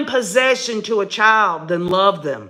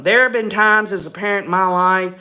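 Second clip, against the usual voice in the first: ordinary talk, nothing marked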